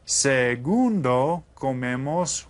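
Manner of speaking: Segundo drawn out slowly with a questioning rise; slow prompting word. Shown as a demonstration. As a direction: stretch it and rise to invite the answer. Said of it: "tell me what the second one is".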